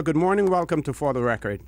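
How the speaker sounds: ordinary talk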